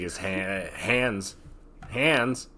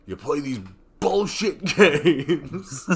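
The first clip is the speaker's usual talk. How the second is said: hammed-up angry rant, building louder into a laugh; mock upset; 4 semitones higher, 5 dB louder than usual. Joking podcaster pretends fury at absent friend over skipped show, mock upset. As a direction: play at being fed up, cracking up by the end. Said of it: "started acting like I was really upset with him".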